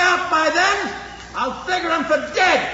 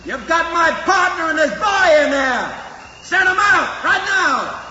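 shouting demand. Acted. Outlaw demands from outside.

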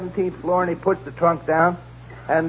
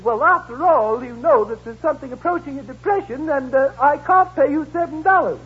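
raised prim lady voice; 8 semitones higher than usual. Acted. Customer to porter, a raised fussy lady voice. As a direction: play the fussy rich lady. Woman haggling in a joke.

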